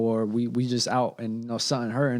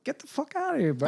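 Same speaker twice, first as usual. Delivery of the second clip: incredulous; 13 semitones higher than usual. Retold or imitated. Incredulous outburst at own story.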